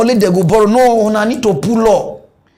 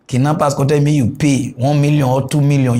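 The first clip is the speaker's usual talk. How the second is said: usual speaking voice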